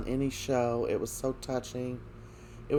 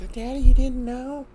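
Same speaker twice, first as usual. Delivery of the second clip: put-on little girl voice; 12 semitones higher, 9 dB louder than usual. Little Rachel voiced to father.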